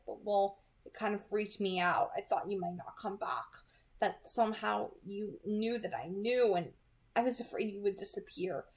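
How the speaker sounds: plain reading voice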